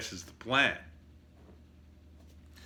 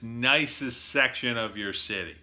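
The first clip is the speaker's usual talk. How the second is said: normal talking voice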